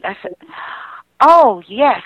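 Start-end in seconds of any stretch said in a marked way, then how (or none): 1.2-2.0 a louder, higher, moved "Oh, yes"; emotional yes. Retold or imitated. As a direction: answer with a lift of emotion. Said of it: "I got really emotional again".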